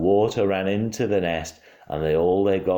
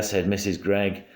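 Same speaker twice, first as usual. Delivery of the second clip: usual speaking voice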